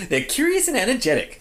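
high, mewing cat voice. Acted. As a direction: purr it like a cat. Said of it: "You sound like a cat".

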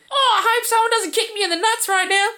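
high fast cartoon voice; squeaky silly commentator; silly voiceover. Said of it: "he has to put in a sound effect".

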